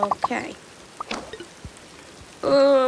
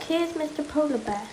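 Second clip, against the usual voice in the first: ordinary talk, nothing marked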